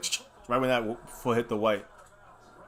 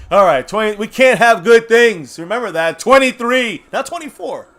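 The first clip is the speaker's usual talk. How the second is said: furious shout; 8 semitones higher, 10 dB louder than usual. Real, not acted. Fan furious at his team's lead, shouting. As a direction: rage at the scoreboard. Furious rant over the score.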